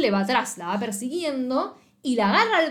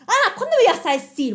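high, loud mocking whine; mocking a friend's overblown jealousy; mock jealous whine; 10 semitones higher, 7 dB louder than usual. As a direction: ham up the jealous accusation. Mocking Jiwon's jealous question.

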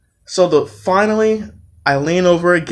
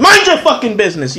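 raised, higher-pitched outburst; yelling; 6 semitones higher than usual. Retold or imitated. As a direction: snap it out like you want to yell. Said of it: "I wanna start yelling and tell".

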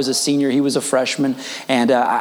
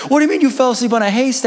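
high, fast mock disbelief; incredulous questioning; 8 semitones higher, much faster than usual. Coach questioning freshman about haystack, incredulous. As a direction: rush it out in amazement.

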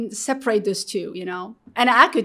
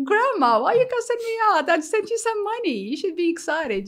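protesting; 12 semitones higher, 4 dB louder than usual. Retold or imitated. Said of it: "I'm like".

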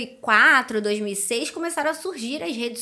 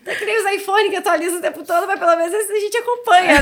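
laughing; 7 semitones higher, 7 dB louder, faster than usual. Real, not acted. Still laughing after joke.

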